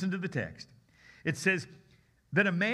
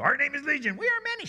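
much higher, louder squeaky demons; many voices crowding in; clamoring demons; 10 semitones higher, 4 dB louder, faster than usual. Shown as a demonstration. Preacher voicing a crowd of demons inside the man, high and loud. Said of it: "there's lots of demons".

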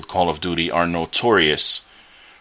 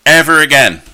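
emphatic shout; 8 semitones higher, 9 dB louder than usual. Real, not acted. Telling off Schlossberg.

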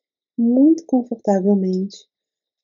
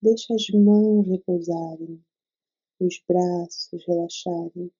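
guided meditation. Acted. Leads a meditation.

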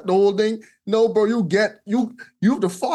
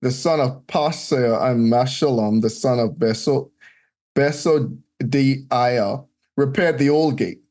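scripture reading; 5 semitones lower than usual. Acted. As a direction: read out the old names like a lector. Reads scripture list aloud.

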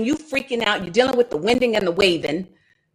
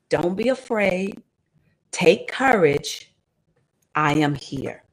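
reassuring; 5 semitones lower, 3 dB quieter, slower than usual. Acted. Jesus heard through the storm.

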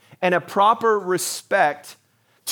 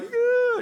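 drawn-out high squeal of pretend fear; mock cowering whine. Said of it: "like, cower in fear".

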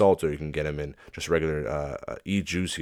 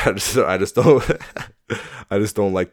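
higher, louder words breaking into laughter; laughing; 4 semitones higher, 9 dB louder than usual. Real, not acted. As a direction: try to talk through real laughter. Breaks into laughter while talking.

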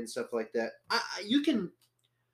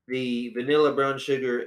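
normal talking voice

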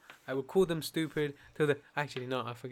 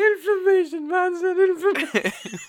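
mock repeat; 9 dB louder than usual. Real, not acted. Teasing a friend for saying inflammation, mocking. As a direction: hammer her slip back at her. Mocking her word slip.

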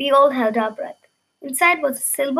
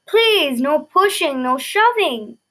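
raised, higher voice calling over a crowd; queen calling over the crowd, raised and higher; 3 semitones higher, 3 dB louder, slower than usual. Acted. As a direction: call out to settle the crowd. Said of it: "Queen Cozy called".

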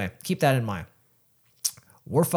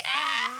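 imitated high scream. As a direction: mimic a friend's piercing squeal, held back in volume. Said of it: "I've never heard him scream that high".

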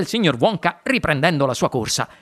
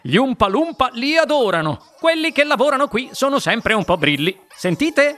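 high, delighted Wonka-like storyteller; excitable showman; 6 semitones higher than usual. A narrator's reading. Wonka on his tipsy Oompa Loompas, high amused showman. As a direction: share it with high-pitched amusement.

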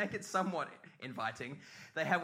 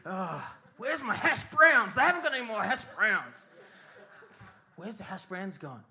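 rising whiny outrage; mock cranky complaint; 6 semitones higher, 3 dB louder than usual. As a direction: complain like a spoilt guest.

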